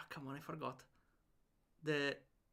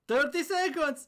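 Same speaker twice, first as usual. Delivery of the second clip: soldier shout; 10 semitones higher, 4 dB louder than usual. Acted. Echoed countdown, higher.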